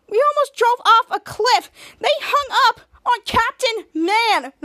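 high, loud, slow indignation; outraged complaint. Acted. Shadow listing what the villains did to them, outraged. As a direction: hammer each grievance.

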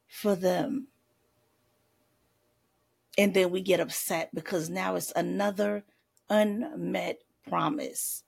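ordinary talk

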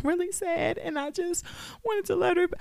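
sad PSA poster. Retold or imitated. Mimicking a pet owner posting grief online, mildly hammed-up. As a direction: put on a lightly sad public-service voice. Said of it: "you trying to make a P S A".

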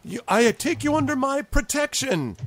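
high-pitched official pronouncement; little dignitary granting a favor; self-important goblin ambassador. Acted. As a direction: declare it grandly in a high goblin voice. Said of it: "he says".

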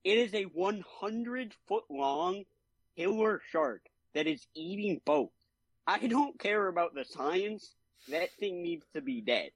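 high-pitched, emphatic mock protest; mildly hammed-up exasperation; exasperated retort. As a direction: argue back in disbelief, stretching key words. Acted exasperated retort to the scientist.

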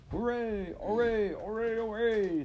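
high, drawn-out hoorays; cheering. A narrator's reading. Weasels at their noisy banquet, cheering in high voices. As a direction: cheer like a crowd of squeaky revellers.

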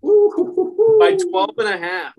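slow, loud and high disbelief; incredulous drawn-out exclaim. Real, not acted. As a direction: stretch it out in shock. Stunned at the big spread.

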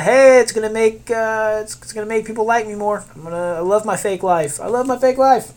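high-pitched imitation of a follower-chaser; mocking, singsong wannabe influencer; mock social-media millennial. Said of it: "I don't know why I'm talking like that".

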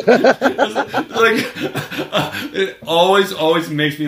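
high, loud words through laughter; laughing. Real, not acted. Fan telling co-host the joke, laughing. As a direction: still cracking up.

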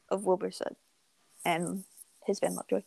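ordinary talk, nothing marked

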